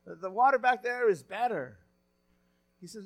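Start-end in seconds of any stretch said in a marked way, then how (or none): 0.0-1.8 nationalistic pride. Retold or imitated. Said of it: "he got a little nationalistic there".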